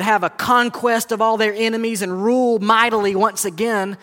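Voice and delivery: impassioned preaching. Real, not acted. Preacher tells congregation why Jesus came, impassioned preaching.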